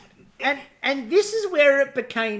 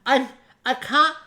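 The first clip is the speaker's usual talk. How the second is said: put-on higher, louder four-year-old whine; whiny child; 5 semitones higher, 5 dB louder, slower than usual. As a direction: trail behind like a small kid complaining. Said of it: "like the four-year-old following behind".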